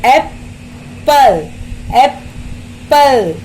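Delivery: Apple split in two slow beats; slow teacher's breakdown of Apple; syllable-by-syllable. Shown as a demonstration. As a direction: pause between एप and पल. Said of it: "दो sound".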